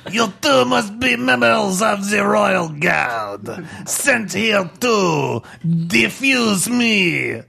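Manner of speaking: slow, declamatory French bomb; French accent. Acted. Bomb addressing supposed Royal Guard, grand French accent. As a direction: proclaim it grandly in hammed-up French. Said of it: "It's got eyes and a French accent".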